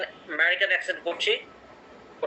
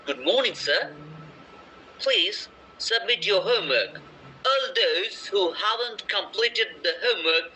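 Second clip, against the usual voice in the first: slow, careful British-accent read-aloud; 2 semitones higher, much slower than usual. Shown as a demonstration. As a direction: model a British accent for students to copy, very slowly. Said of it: "প্রথমে পড়ছি British অ্যাকসেন্টে".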